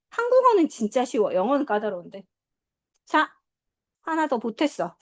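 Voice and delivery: ordinary talk, nothing marked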